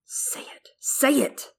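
whispered, pressing demand; menacing whisper. Acted. Co-host as Jaime whispering to force Lancel's admission. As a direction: hiss it, forcing a confession.

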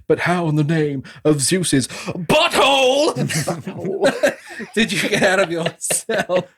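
slow, high build to an explosive word; hammed-up movie-star exasperation with odd emphasis; Nicolas Cage. Imitating a movie hero asking about a cell escape, wildly over-emphatic. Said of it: "he does that complete Cage thing".

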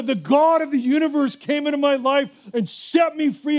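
a shouting preacher's praise; preaching shout. Real, not acted. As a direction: proclaim it with full force. Preaching testimony at full force.

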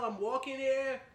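loud mock New York yell; a hammed-up New York shout. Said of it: "you guys yell".

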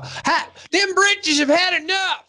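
very high, laughing exclaim; high squealing joke. Acted. Host topping the britches joke, high shriek. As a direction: shriek it as a punchline.

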